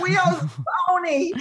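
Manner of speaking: put-on high, drawn-out little girl's whine; toddler with speech impediment. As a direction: imitate a small child who can't say R's. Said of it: "she had a speech impediment".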